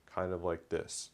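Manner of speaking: deliberately slowed, relaxed delivery. Shown as a demonstration. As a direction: drag it out as if a bit drunk. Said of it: "slow it down till you're, like, almost drunk".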